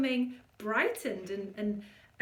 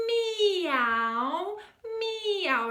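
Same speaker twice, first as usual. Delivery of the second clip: higher sung meow; kitten meow; 6 semitones higher, 4 dB louder than usual. Shown as a demonstration. Coach demoing a feline meow. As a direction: sing meow into the whiskers. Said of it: "a kitten sound".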